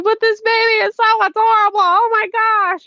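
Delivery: put-on high, loud baby-gush voice; high-pitched gushing at a cute baby; gushing over a baby. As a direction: squeal over how adorable the baby is. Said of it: "why am I crying because I see a cute baby".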